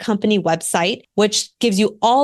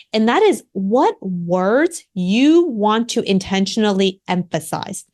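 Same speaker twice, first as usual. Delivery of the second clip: slow down and punch "words", "you" and "intentionally"; "what words you want" spaced out slowly, each key word stressed; deliberate emphasis; slower than usual. Shown as a demonstration. Coach explaining inflection to listeners, deliberately emphasizing key words. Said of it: "emphasizing can be the slowing down of the word".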